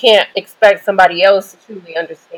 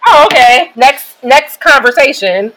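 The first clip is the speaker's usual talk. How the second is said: mock brush-off, higher and louder at the start; dismissive; 5 semitones higher, 4 dB louder than usual. Listeners blowing off her heartfelt sharing, mock dismissive. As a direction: imitate someone hurrying to change the subject. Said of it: "they blow me off".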